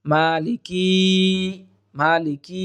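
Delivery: "মালিকি" with the final "kee" drawn out; wrong pronunciation. Shown as a demonstration. Teacher showing how many read it, mistaken long ending. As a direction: stretch the end of "মালিকি". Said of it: "এখানে আমরা ভুল করে থাকি".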